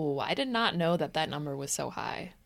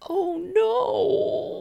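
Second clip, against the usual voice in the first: pinched voice; 4 dB louder than usual. Pretend. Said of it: "he's like".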